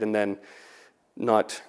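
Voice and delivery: ordinary talk, nothing marked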